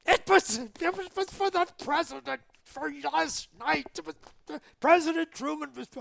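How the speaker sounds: high-pitched sputtering fury; angry sputtering. Retold or imitated. The colonel rants at the pilot about President Truman, high sputtering fury. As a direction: spit it out in a high furious rant. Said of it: "he was so mad at me".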